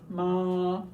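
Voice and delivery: "ma" held long on one level pitch; high tone. Shown as a demonstration. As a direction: sustain "ma" without moving the pitch. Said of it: "You have a high tone".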